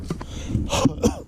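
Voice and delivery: rough cough; coughing fit. Real, not acted. Coughing after the ride.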